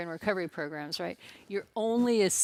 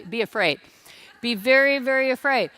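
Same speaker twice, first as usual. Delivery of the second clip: loud, pitched-up mock ominous warning; hammed-up be very afraid; mock dire warning; 4 semitones higher, 7 dB louder than usual. Preacher teasing the congregation, loud mock warning.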